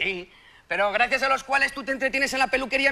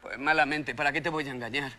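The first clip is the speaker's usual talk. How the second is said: very low, subdued voice; low glum murmur; dejected admission; 12 semitones lower, 7 dB quieter than usual. Acted. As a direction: confess it quietly, defeated. Admits he earns badly.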